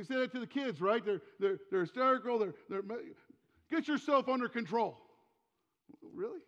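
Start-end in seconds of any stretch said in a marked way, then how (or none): 3.7-5.0 high, exasperated mock reprimand; raised-voice retold telling-off; scolding parent. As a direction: imitate a parent snapping at frantic kids. Imitating parent scolding hysterical kids.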